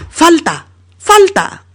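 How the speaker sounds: agudo y fuerte, reclamando falta; grito de protesta. Acted. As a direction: gritarlo indignado al árbitro. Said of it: "Y Paco grita". (Paco grita falta, agudo y fuerte)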